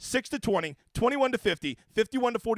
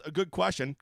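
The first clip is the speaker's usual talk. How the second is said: normal talking voice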